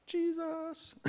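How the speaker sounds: Jesus in a sudden high squeak. Real, not acted. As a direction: pop the answer up high and playful. Punchline answer to own question.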